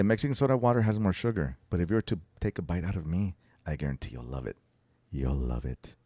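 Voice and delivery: low, soft seductive murmur; seductive praying voice. Retold or imitated. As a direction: croon it low like a prayer. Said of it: "he's saying it like this, like if he's praying".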